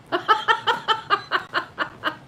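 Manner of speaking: high, loud burst of laughter; real peals of high laughter, trailing down; big laugh. Real, not acted. Woman laughing over her aunt's easy menopause, high cackling laugh.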